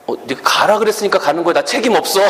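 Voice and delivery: quick, high mock smug excuse; smug boyfriend. Boyfriend dodging blame, mock smug. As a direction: shrug it off quickly.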